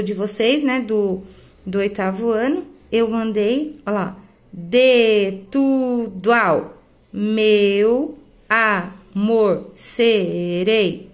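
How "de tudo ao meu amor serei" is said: very slow, each syllable drawn out; stretched syllables, stopping on the stressed one; syllable-by-syllable scansion. Shown as a demonstration. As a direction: scan the verse one syllable at a time. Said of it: "eu só conto até a sílaba tônica".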